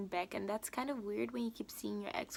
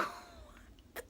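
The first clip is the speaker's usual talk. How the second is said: laughing disbelief; 5 dB quieter than usual. Real, not acted. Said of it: "Guys, I laughed at this part".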